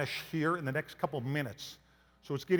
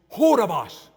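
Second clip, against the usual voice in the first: loud, higher mock broken English; broken English accent; 4 semitones higher, 9 dB louder, slower than usual. Exasperated guide questioning the husband, broken English accent. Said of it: "real broken English accent".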